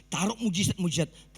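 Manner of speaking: ordinary delivery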